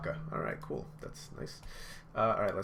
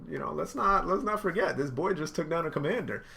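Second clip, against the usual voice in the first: higher, louder excited praise; hyped praise; 7 semitones higher, 4 dB louder than usual. Real, not acted. Praising Luffy to viewers, higher and louder with excitement. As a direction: hype him up.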